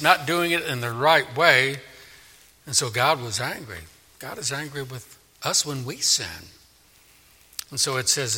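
ordinary talk, nothing marked

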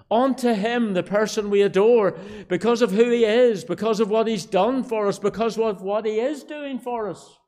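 loud, high, impassioned proclamation; ringing pulpit climax; full-force preaching. Real, not acted. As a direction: build the refrain phrase by phrase at full voice.

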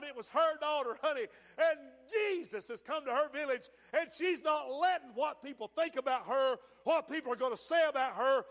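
loud, driving revival sermon; fervent pulpit testimony, key words shouted; full-force preaching. Acted. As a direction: drive each phrase out to the congregation.

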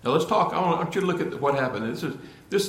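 ordinary talk

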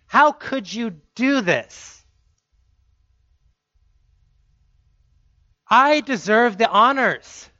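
aggrieved son demanding his due; indignant older son. Acted. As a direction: plead your own case to your father. Older brother's indignant complaint.

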